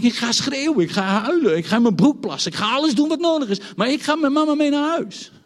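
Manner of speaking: high, loud, rising tantrum threats; defiant toddler threats. Retold or imitated. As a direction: build to a stubborn child's ultimatum.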